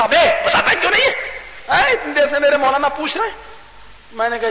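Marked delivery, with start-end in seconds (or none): 0.0-3.4 high, impatient demand; irritated prodding. Acted. Neighbour elbows silent worshipper to answer, irritated. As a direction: needle him to answer, pitched up. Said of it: "कौनी मार के कहता".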